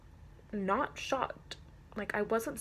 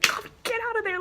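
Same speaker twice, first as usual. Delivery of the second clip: high, loud imitated shout; retold yell; 13 semitones higher, 6 dB louder, faster than usual. Owner to hamster stuck under couch, retold high yell. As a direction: re-enact yelling at a pet, pitched way up. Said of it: "I was yelling at my hamster".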